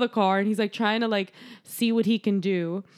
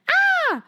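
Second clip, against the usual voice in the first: short high shriek, a bit louder; high mock scream; 5 dB louder than usual. Nurse shrieking as the hand comes in. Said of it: "she's like".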